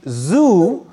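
Zoo with a buzzing z, tongue behind teeth; z sound. Shown as a demonstration. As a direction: Zoo said like the English zoo. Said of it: "Your tongue is inside your teeth".

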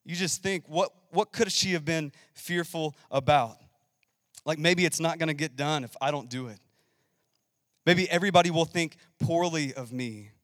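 normal talking voice

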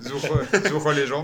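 slow mock-German echo, laughing. Host echoing his co-host's German joke, mock German. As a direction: draw out the comic German words. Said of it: "il va nous le faire en allemand".

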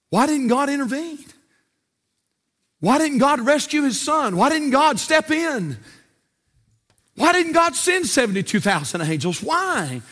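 high, pleading preacher's questions; impassioned preaching. Acted.

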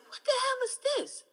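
high, fast, disbelieving outburst; baffled outburst. Retold or imitated. Retelling her first reaction to the show, high disbelieving outburst. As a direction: blurt it out, completely thrown by the show. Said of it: "I was like".

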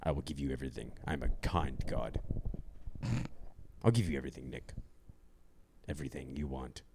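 very low, hushed god-king voice; Xerxes. Retold or imitated. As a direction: softly promise riches in a deep voice. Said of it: "the Persian god Xerxes".

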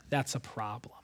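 breathy stage whisper of worry. Acted. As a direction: drop to a whisper, letting the danger sink in. Dramatic aside about flooding boat.

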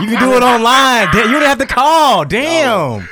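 high, loud exasperated mother; nagging mom voice. Retold or imitated. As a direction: nag him like an annoyed mom.

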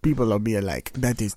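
put-on muddled Russian-style accent; mock Slovak accent. Imagining listeners' complaints, mock Slovak accent. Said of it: "terrible accent".

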